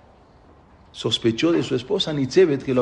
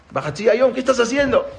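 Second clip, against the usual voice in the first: mild mock alarm, pitched high; startled questioning; 11 semitones higher, 4 dB louder than usual. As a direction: ask as a startled mother, voice jumping up. Mother startled by son coming home.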